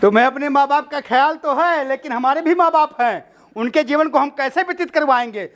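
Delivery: high-pitched, oratorical retort; gang boss's speech. Acted. Boss answers the captive's plea. (सरगना का ऊँचे सुर में भाषण)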